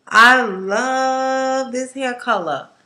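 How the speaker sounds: slow, loud adoring; gushing love. Real, not acted. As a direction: savour each word. Gushing about hair dye.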